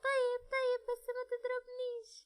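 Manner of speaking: put-on high, frightened little girl giving in; a scared child begging not to be hit; pleading little girl. (بنت صغيرة خايفة بتترجى ما يضربهاش)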